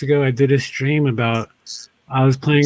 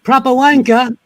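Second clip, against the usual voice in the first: mock British accent; 7 semitones higher, 6 dB louder than usual. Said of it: "proper wankered".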